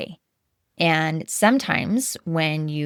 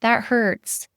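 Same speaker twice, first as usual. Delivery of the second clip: put-on much higher, soothing parent voice to a fallen toddler; comforting a hurt child; 8 semitones higher than usual. Parent soothing a child who fell, high gentle coo. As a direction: coo it like scooping up a crying kid. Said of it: "We scoop them up and say".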